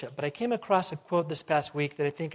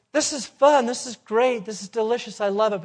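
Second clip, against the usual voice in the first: louder, higher mock enthusiasm; imitated delight; 6 semitones higher, 7 dB louder than usual. People enjoying sin like a cream puff, imitated delight. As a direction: gush as someone taken in by temptation.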